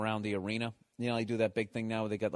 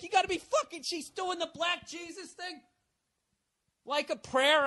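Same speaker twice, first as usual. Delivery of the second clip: much higher incredulous outburst; can't believe what he's seeing; mock disbelief. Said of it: "at first I'm like".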